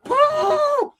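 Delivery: high, far-off woo cry; hammed-up high woo; mock woo yell. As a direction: toss out a high woo like he did. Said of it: "He just was like".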